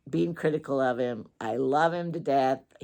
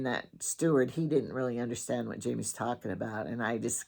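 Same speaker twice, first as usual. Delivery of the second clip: ordinary talk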